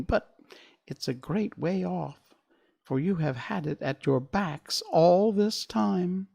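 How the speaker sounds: a lighter touch of the high mouse queen voice; squeaky little voice. A narrator's reading. Mouse queen telling Dorothy the way, lightly squeaky. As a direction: keep the mouse queen's small squeak going. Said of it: "in her squeaky little voice".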